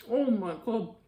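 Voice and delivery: ordinary talk, nothing marked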